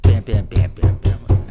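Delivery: loud, low bam bam bam like fists on keys; loud banging noises. Retold or imitated. Teacher and pupil pounding the piano together, loud bam sounds.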